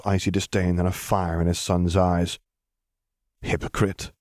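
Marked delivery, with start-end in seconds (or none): 3.4-4.1 cold, flat accusing word; flat accusation. Acted. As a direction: drop it flatly, no emotion. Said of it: "he accused flatly".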